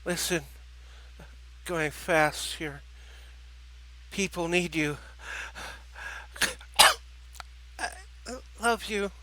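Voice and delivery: weak, halting words with a cough; struggling to speak. Acted. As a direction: push each word out while dying. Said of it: "made great effort to speak".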